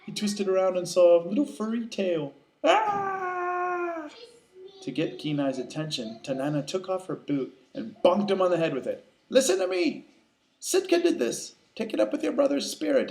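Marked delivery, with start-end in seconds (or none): 2.6-4.1 very high, drawn-out scream; startled scream. Acted. Bear sees own furry tail. 9.3-13.1 put-on high, insistent old shamaness; scolding wise woman.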